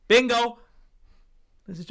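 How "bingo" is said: loud high shout of Bingo; roared. Retold or imitated. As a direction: shout it out across the hall. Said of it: "Next minute she roared".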